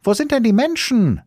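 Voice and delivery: put-on very high, loud child-character call; little boy's piercing call. A narrator's reading. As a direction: shout the question up to the tower. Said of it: "rief der kleine Häwelmann hinauf".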